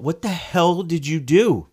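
high, slow, stunned outburst at the driver; demanding answers in disbelief; shocked yell. Retold or imitated. Husband confronting the driver at her car, stunned yell.

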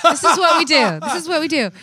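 high, loud mock pitch; a hint of a hyped producer. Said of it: "They're like".

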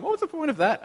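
high, puzzled mock question; baffled. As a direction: squeak it out in disbelief. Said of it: "And you walk away going".

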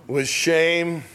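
slow reading fading to a whisper; solemn lyric recital. Acted. Preacher reading the hymn line, hushed at the end. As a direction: let it sink to a hushed whisper.

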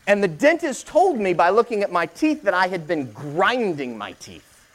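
ordinary talk, nothing marked

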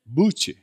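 Buche said as two syllables, BOO-cheh; Spanish-style pronunciation. Shown as a demonstration. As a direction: give Buche its Spanish ending. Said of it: "pronounce that with our accent".

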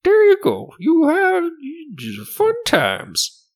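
very high, loud merchant voice, slowing at the end; haughty shopkeeper. Acted. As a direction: send them off in a loud falsetto. Shopkeeper closes the sale.

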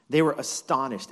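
normal talking voice

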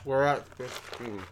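slow words through a mouthful of candy; mouth full. Real, not acted. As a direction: mumble past stuffed cheeks. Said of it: "you're incapable of speaking".

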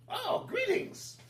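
very high, slow, loud squeak; squeaky goblin greeting. Acted.

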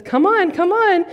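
put-on high, coaxing mother calling her toddler; coaxing mom. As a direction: coax the child up the escalator. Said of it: "and she says".

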